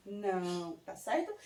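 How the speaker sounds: normal talking voice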